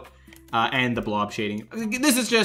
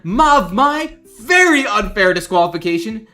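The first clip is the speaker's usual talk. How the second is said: loud, high, drawn-out dramatic complaint; mock outraged shouting; 12 semitones higher, 12 dB louder, much slower than usual. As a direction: wail the grievance word by word, hammed-up. Acts out angry viewer's donation message.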